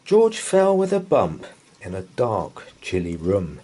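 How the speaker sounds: plain reading voice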